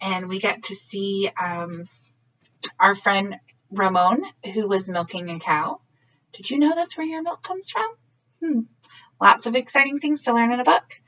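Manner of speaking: ordinary delivery, nothing marked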